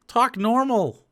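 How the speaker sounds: ordinary delivery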